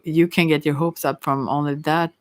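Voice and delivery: ordinary talk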